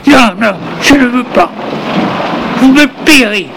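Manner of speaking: high, pleading cry of refusal. Acted. Maurice cries out against the doctor's order, high pleading protest. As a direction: beg them to stop, voice pitched high. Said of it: "Maurice se met à crier".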